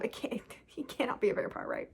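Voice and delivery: whisper. Real, not acted. Mock denial of vampire theory.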